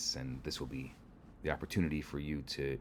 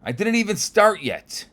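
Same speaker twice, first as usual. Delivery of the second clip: much higher, much louder exasperated snap; angry snap; 14 dB louder than usual. Acted. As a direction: snap at the snorer at full force. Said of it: "And the rabbi snaps".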